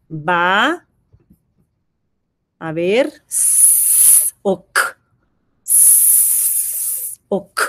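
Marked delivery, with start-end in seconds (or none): 3.3-4.3 quiet unvoiced sss; a soft breathy hiss for S. Shown as a demonstration. Teacher sounding out a word for a child, soft hiss. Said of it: "sounding out letters". 5.6-7.2 quiet unvoiced sss; a soft breathy hiss for S. Shown as a demonstration. Teacher sounding out a word for a child, soft hiss. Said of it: "sounding out letters".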